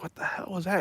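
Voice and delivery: a wary half-whisper in the dark garage; softly asking what the noise was; startled hushed question. Retold or imitated.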